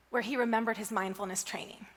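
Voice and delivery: ordinary talk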